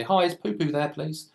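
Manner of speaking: put-on high, cheeky kid asking for Poo Poo; cheeky prank voice. Kid prank-calling a stranger, high and cheeky. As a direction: put on a sweet innocent caller voice.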